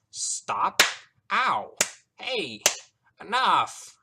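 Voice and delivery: high, loud separate cries; yelping under blows. A narrator's reading. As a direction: cry out once per hit. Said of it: "spoke his own words after every".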